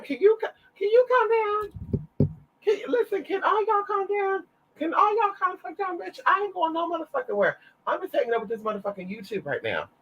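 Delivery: high exasperated pleading; exasperated high scold. Real, not acted. Scolding the excited barking dogs.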